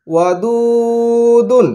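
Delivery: very slow, long-held blend; drawn-out joined word. Shown as a demonstration. Teacher showing pupils how syllables join, very slow. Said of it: "যুক্ত করে".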